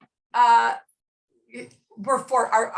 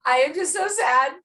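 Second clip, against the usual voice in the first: mock weeping daughter; 6 semitones higher than usual. Twelve-year-old daughter crying at mother's language, mock sobbing. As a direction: play the devastated child, half laughing.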